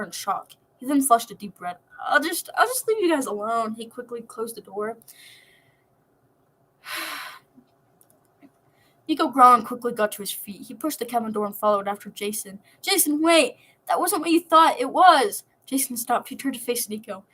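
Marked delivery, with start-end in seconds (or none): 2.0-3.7 flustered retreat. Acted. Embarrassed Jason backs out. 12.8-15.4 higher and louder, pleading; desperate call. Acted. Nico running after Jason, shouting to explain.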